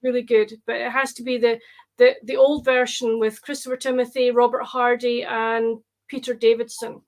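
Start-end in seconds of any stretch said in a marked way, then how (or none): none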